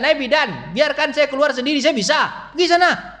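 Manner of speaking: high, loud mock tantrum of a newborn; a pushy baby ordering the midwife out, pitched high; bossy baby yelling. Preacher voicing a baby shouting at the midwife, high and loud.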